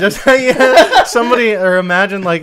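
ordinary talk, nothing marked

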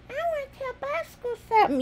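high, quick little pretend frog; toy frog voice. Frog toy wants to tell Bosco something, high pretend voice. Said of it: "Froggy's gonna talk for Bosco".

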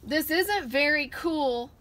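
mild complaint. Acted. Tired cub complains to Mommy on the hot ledge, a slow whine. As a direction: drag it out like a tired grumpy cub. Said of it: "complained Little Bear".

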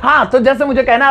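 ordinary delivery

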